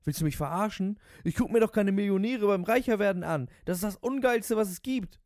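high, loud cabbie outrage; indignant rant. Acted. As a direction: protest as if deeply insulted.

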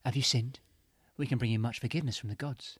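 cheesy ad read, loud and upbeat; advert pitch. Acted. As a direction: sell it like a late-night advert. Spiritual healers' advert pitch.

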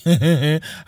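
loud, high mocking belly laugh; hearty laugh. Policeman laughing heartily at the plea to be arrested. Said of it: "laughed heartily".

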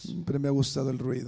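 soft, low-pitched confession; hushed aside. Real, not acted. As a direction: say it low and quietly to a silent room. Hushed confession to silent church.

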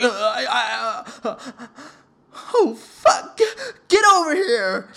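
stammer, then burst out high and loud; hammed-up overjoyed exclamation; overwhelmed shock. Sister's stunned reaction acted out.

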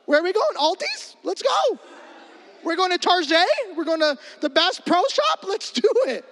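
high, cheery and eager, every line bouncing up; overly nice Missouri guy. Retold or imitated. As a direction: chirp it like a super friendly Midwesterner up for anything. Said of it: "Missouri people are so nice".

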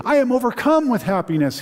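high, fast gush of joy; the father cries out his joy to Joseph; overjoyed cry. Acted. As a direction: burst out in tearful gladness. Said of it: "he cried".

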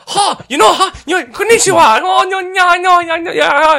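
very high, loud babble; hammed-up, excitable Japanese caricature; mock Japanese caricature. Player re-enacting the Japanese clue, mock caricature babble. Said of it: "and she's just like".

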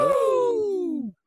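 high wrestling-fan whoop; excited woo. Real, not acted. Greeting Wrestling Hound.

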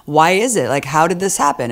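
mock puzzlement. Host mimics people involved in the debate, mock puzzlement. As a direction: act out people acting suspiciously puzzled.

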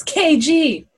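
high, long-stretched nickname call; excited hustler calling out. Acted. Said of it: "remember what he calls him".

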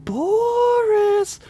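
high, drawn-out spectral call; gentle ghostly whisper. Acted. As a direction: call his name from far away like a ghost. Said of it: "a gentle whisper blew through the wind".

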